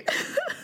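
high squealing laugh; burst of laughter. Real, not acted. Laughing hard at how the article reads. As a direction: cracks up laughing.

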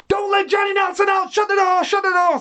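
urgent high-pitched shout; Brendan. Retold or imitated. Trainer ordering the exit blocked, shouting urgently. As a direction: imitate a trainer yelling orders across the gym. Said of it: "Brendan said".

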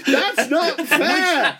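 slow words through high laughter; laughing protest. Real, not acted. Laughing protest at dodged question.

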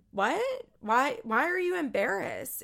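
high, sharp mock "what?" in disbelief; retold disbelief. Amber questioning Matt about his embarrassment, surprised. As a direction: echo her stunned confusion at him.

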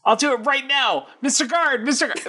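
high, eager little snitch voice; tattletale. Acted. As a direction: call the guard like a tattling kid. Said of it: "You're like a tattletale".

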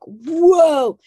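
high, loud stunned whoa; overwhelmed whoa. Retold or imitated. Someone hit by a huge conflict, high and loud whoa. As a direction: let it knock you back, high.

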